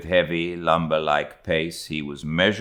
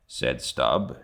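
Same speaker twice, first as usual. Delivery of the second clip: usual speaking voice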